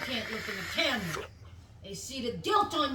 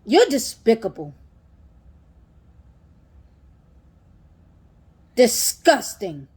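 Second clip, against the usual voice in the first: booming verdict, much louder; shouted judgement of guilt; loud accusation; 14 dB louder than usual. Real, not acted. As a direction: slam the verdict down. Shouted condemnation.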